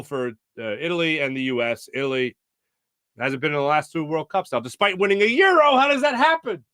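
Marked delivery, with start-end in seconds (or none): 4.6-6.6 high, loud incredulous burst; exasperated disbelief. Real, not acted. Host baffled that Italy missed two World Cups, incredulous.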